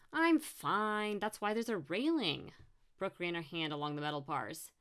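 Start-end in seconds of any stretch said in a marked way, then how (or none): 0.1-2.5 higher, loud brush-off; exasperated comeback to a fussing friend; dismissive retort. Acted. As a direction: brush off the worry impatiently. Brooke brushes off the warning.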